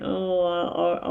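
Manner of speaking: ordinary talk, nothing marked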